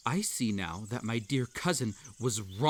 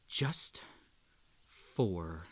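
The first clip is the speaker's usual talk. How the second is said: dropping lower and softer to a near whisper; fading quiet; 8 semitones lower, 8 dB quieter, much slower than usual. Acted. Character tells the listener, fading off, it was just for them. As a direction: let it sink away on the last word. Sinister closing line to listener.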